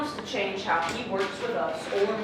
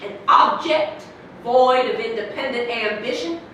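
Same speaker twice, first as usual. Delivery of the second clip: ordinary talk, nothing marked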